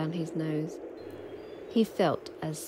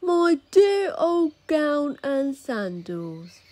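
slow, higher, loving delight; tender joy; 8 semitones higher, 4 dB louder, much slower than usual. A narrator's reading. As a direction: savour each word like a reunion. Giant overjoyed at gown.

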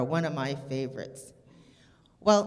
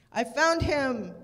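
higher, louder, slow triumphant call; 8 semitones higher, 6 dB louder, slower than usual. A narrator's reading. Police officer announcing to the searchers, loud relieved call. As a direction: shout the good news across the woods. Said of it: "cried a police officer".

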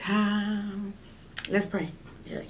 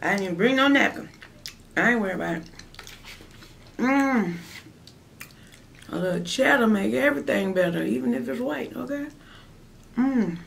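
eating between words; talking while chewing; faster than usual. Real, not acted. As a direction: mouth partly full. Seen 0: Bite at -7, she keeps talking with fork, mouth working throughout.

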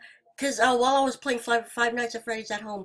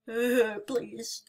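ordinary talk

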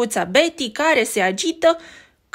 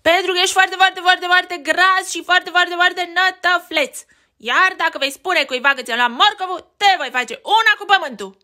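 bully rabbit taunt; 8 semitones higher, 4 dB louder than usual. Acted.

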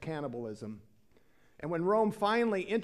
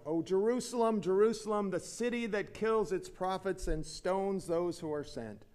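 ordinary delivery

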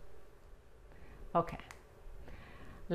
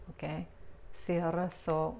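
normal talking voice

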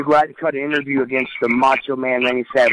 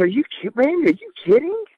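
retold disbelief; 10 semitones higher, faster than usual. Retold disbelief at Randy backstage.

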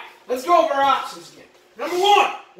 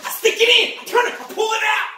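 usual speaking voice

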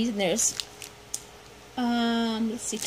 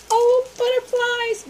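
delighted squeal; 12 semitones higher, 5 dB louder than usual. Real, not acted. Discovering butterfly gift.

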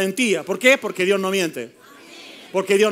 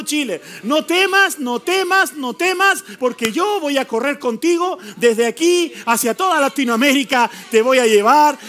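raised, higher cries of no temas; prophetic preaching; 3 semitones higher than usual. Acted. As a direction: proclaim God's promise to Chile with fervor. Prophetic proclamation to the church. (proclamación profética con fervor)

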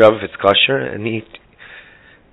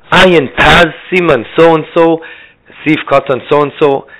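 louder, higher and drawn-out mock yell; retold scream; 5 semitones higher, 4 dB louder, slower than usual. Girl repeating herself to the Rav, retold scream. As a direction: call it out slowly, as if not understood. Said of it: "So she screamed it out".

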